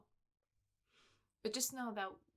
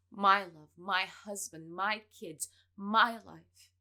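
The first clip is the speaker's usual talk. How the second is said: each "My" punched much louder; stress on MY; 13 dB louder, much slower than usual. Acted. Wife tells her rival what she wants, punching each "my". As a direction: hammer every "my" like a claim.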